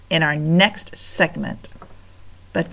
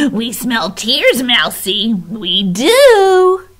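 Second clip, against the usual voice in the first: taunting rats; 5 semitones higher, 8 dB louder, slower than usual. Acted. Rats taunting crying mouse.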